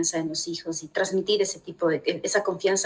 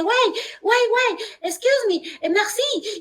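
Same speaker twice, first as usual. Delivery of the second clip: urgent call; 12 semitones higher, slower than usual. Retold or imitated. Husband calling to stop suitcase.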